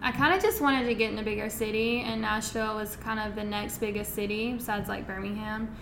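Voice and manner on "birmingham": usual speaking voice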